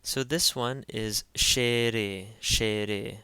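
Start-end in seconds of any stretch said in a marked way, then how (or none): 1.3-3.2 "shere" as SHEH-reh, both syllables voiced; SH sound. Shown as a demonstration. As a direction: slowly sound out "shere" starting with SH. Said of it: "takes an S H sound".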